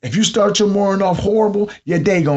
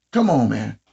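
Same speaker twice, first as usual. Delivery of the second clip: normal talking voice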